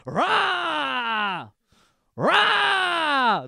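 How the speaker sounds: monster roar. Pretend. Imitating Freddy's roar.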